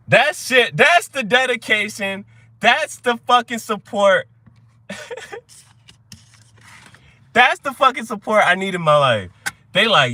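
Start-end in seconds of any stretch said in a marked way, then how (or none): 0.1-8.4 higher, slow, punched-out appreciation; emphatic praise. Real, not acted. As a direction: hammer out the praise word by word. Praising a loyal viewer's comment.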